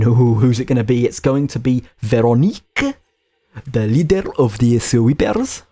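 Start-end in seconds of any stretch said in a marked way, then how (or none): 2.0-3.0 Veronique said the French way, slow with a rising end; French accent. Pretend. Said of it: "I hope you liked my, uh, French there". 3.7-5.6 put-on slow, lilting French accent. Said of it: "I hope you liked my, uh, French there".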